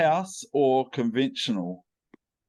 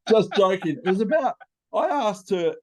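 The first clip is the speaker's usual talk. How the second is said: ordinary talk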